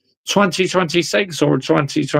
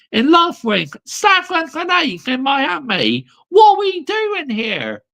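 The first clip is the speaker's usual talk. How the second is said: raised voice, high and slow, ranting at the team; louder, harder rant; 6 semitones higher than usual. Real, not acted. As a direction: give the chat the hard-hitting rant they asked for. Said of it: "But you want me to talk a bit harder".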